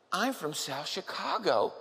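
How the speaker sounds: slow, a hint of a sassy queen; drawn out retort, lightly played; lightly voiced queen. Queen voiced in retold story.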